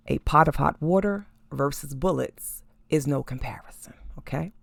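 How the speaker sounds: hushed emphatic whisper. Real, not acted. Whispering the point to viewers, hushed.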